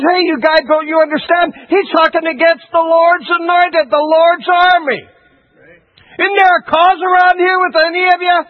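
high, urgent appeal, acting out David; indignant outcry. Preacher as David challenging the idle army, indignant. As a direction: rouse the troops with mounting indignation.

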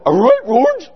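high, goofy cartoon dog answer. Astro the dog answering his owner George, high and goofy. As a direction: chirp it like an eager cartoon dog. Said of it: "Astro would go".

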